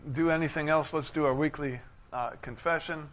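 usual speaking voice

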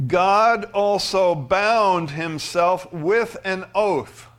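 high, loud, slow scripture proclamation; scripture reading. Acted. Preacher reading Hebrews to the congregation, slow and loud. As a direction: proclaim the verse slowly and loudly. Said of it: "verse seventeen says this".

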